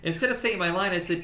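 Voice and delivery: ordinary talk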